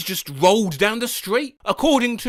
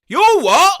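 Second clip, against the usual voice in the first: surprised; 11 semitones higher, 7 dB louder than usual. Acted. Acted moose sketch.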